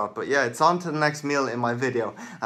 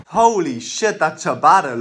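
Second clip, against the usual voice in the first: louder, higher burst of delight at the bread; excited outburst; 4 semitones higher, 9 dB louder, slower than usual. Real, not acted. Vlogger spotting a great ciabatta, excited outburst. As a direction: blurt it out, thrilled.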